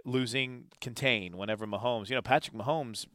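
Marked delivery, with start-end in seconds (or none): none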